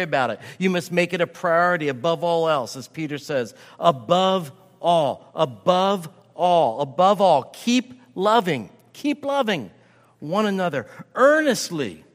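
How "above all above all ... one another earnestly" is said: building exhortation. Acted. Preacher urging the church to love, swelling louder. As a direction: build each above all, then lift into keep loving.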